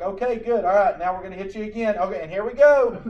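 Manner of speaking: upbeat doctor. Retold or imitated. Medic telling patient he'll be hit again, upbeat. Doctor voiced in retold story.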